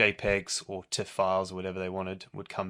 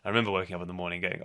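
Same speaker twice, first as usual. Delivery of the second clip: usual speaking voice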